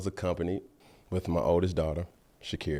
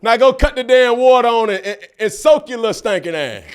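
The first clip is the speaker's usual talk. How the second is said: scolding dad; 10 semitones higher, 10 dB louder than usual. Retold or imitated. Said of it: "That's how I talk to my kid".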